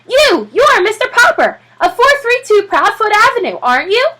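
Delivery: high, loud eager voice; high excited reporter; excited young man. Acted. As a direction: spot your target with glee. Reporter voiced, high, different timbre.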